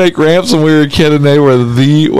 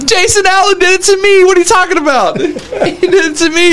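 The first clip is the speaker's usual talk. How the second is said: laughing; 9 semitones higher than usual. Real, not acted. Laughing outburst about friend's prank.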